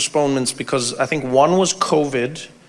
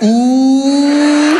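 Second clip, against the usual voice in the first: mock ooh; 10 semitones higher, 8 dB louder than usual. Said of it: "you were like".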